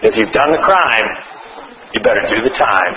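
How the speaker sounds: deep mocking tough-guy voice; mock stern saying. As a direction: growl the old cliché like a gruff sheriff. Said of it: "is then reduced to".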